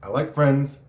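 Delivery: loud, high venting; loud mock rant about hating people; retold blunt refusal. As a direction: punch out the refusal. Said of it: "I'm like".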